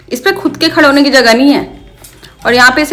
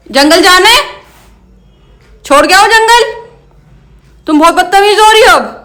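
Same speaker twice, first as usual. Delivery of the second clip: scolding; 8 semitones higher, 4 dB louder than usual. Real, not acted. Scolding the parrot Sara.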